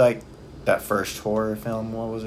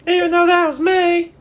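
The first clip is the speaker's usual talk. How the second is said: high pitch; 9 dB louder, slower than usual. Pretend. Playful high-voice bit.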